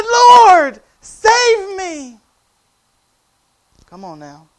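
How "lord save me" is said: desperate, high full-voiced scream; huge shout for rescue; crying out. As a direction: bellow it as if sinking under waves. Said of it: "He cried out".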